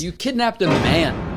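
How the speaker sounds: slow line with a drawn-out "man"; exaggerated, lengthened punch on "man"; stress on man. Shown as a demonstration. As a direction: stretch "man" out with heavy emphasis. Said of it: "I emphasize the man so much".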